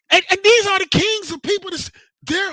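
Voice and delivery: rising into a heated rant; getting excited. Real, not acted. Ranting about online arguers, heated excited outburst. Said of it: "I'm getting excited again".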